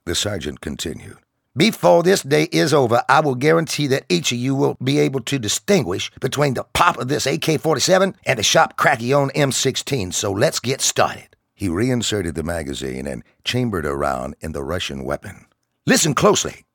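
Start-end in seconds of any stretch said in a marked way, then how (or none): 1.5-11.4 higher, louder barking sergeant voice; drill sergeant. Acted. Sergeant lectures recruits. 15.9-16.6 higher, louder barking sergeant voice; drill sergeant. Acted. Sergeant commands.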